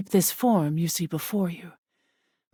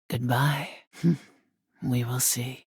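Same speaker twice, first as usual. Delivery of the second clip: ordinary delivery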